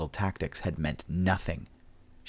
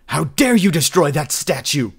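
high, loud furious yell; enraged shout over a destroyed statue; raging shout; 8 semitones higher, 8 dB louder than usual. A narrator's reading. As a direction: scream it at him in outrage. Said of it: "Cheer shouted in his rage".

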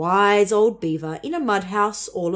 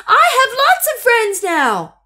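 high, loud, slow happy shout; 8 dB louder than usual. A narrator's reading. Little beaver shouting his happy news to the pond, high loud shout. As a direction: shout it out with joy. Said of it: "he said it again very loudly".

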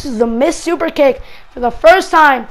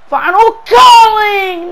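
excited call; 6 semitones higher, 5 dB louder, slower than usual. Real, not acted. Commentator calls finishing move.

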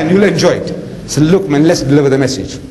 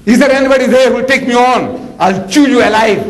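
giant's taunting shout; 10 semitones higher than usual. Retold or imitated. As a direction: boom it out like a swaggering giant. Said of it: "this Jalut is shouting".

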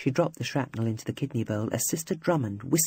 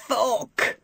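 sudden cry of pain, higher; pained outburst; 9 semitones higher than usual. A narrator's reading. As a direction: blurt it out as the pain hits. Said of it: "The escaped word ricocheted around the ward".

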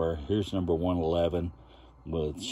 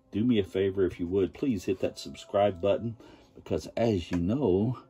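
usual speaking voice

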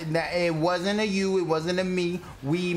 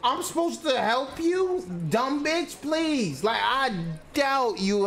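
much higher, slow exasperated yelling; real fury, pitched way up; high-pitched rant; 8 semitones higher, slower than usual. Real, not acted. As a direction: tear into a teammate in disbelief. Berating teammate over voice chat.

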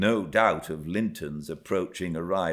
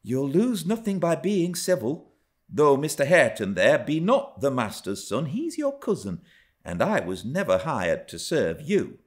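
raised-pitch, civil rebuke; reproving servant woman; 8 semitones higher than usual. A narrator's reading. Servant woman telling Miss she wasn't hired to serve, reproving voice. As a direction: correct the child firmly.

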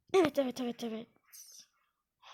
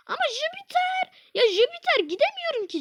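high, frustrated whine; whiny protest; 10 semitones higher than usual. Real, not acted. Gamer whining at not reaching Jupiter.

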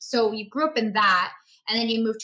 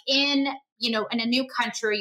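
usual speaking voice

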